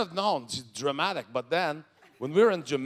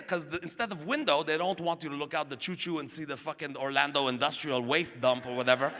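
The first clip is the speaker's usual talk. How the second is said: ordinary talk, nothing marked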